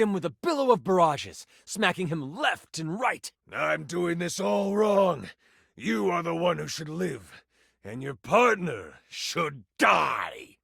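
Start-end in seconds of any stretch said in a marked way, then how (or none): 3.5-10.6 slow, drawn-out beast villain; menacing villain voice. Acted. Tiger villain decides the partner should die, slow menace. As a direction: relish the threat word by word.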